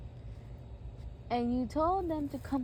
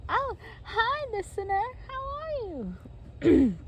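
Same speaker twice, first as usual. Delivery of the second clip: put-on high, girly character greeting; high-pitched drawn-out hello; Smurfette; 11 semitones higher, 3 dB louder, slower than usual. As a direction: greet in a high voice, stretching the end. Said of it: "And Smurfette says".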